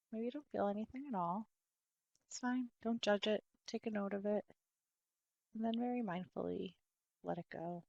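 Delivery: soft body-scan voice with long pauses; guided meditation. Real, not acted. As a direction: gently guide attention through the body, softly and unhurried.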